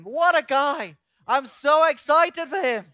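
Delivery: loud, high, gushing admiration; acted excitement. Trinity admiring the man they planned, hammed-up excitement. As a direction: gush over him, stretching the words.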